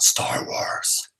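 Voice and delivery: secretive whisper. Acted. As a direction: whisper the reveal.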